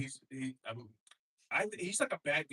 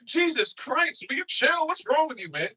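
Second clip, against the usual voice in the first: exasperated yell; 14 semitones higher, 9 dB louder than usual. Acted. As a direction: lose your patience at full volume. Comic yelling at Trump.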